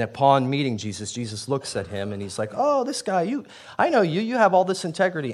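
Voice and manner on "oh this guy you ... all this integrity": delighted. Retold or imitated. Jesus greets Nathaniel warmly.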